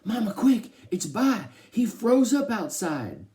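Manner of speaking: high, loud, alarmed shout; urgent yell. A narrator's reading. Kenny bursts in to the family, urgent high yelling. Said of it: "Mom and Dad and Joey all yelled".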